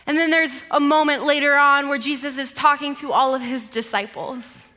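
ordinary delivery

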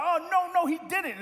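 sassy offended comeback; mock indignation. Pastor acting out his offended younger self, mock indignant. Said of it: "I was starting to get worked up".

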